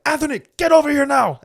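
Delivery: high, loud barked order across the room; mock yell. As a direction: call him over like a furious official. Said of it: "they start yelling at me".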